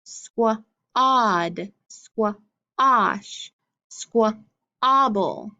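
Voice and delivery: Squad, squash, squabble with a drawn-out ah; slow the middle ah down; stretched ah in the middle. Shown as a demonstration. Teacher modeling words for class to echo, ah drawn out. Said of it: "Listen for the sound in the middle".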